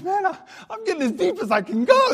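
very high, loud comic protest; mock protesting shout. Preacher answers lady who called him simple, comically shouting. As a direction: yell it in exaggerated, high-pitched indignation.

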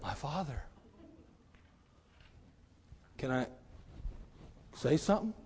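a quiet, careful ask; soft, cautious request. Retold or imitated. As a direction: approach the angry master gently. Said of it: "He's probably like".

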